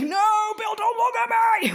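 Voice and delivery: high, loud shriek of No, Bill; mock panicked shout. Flustered vlogger yelling at Bill not to look, shouting high. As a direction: yelp it at him in playful alarm. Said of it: "I'm like".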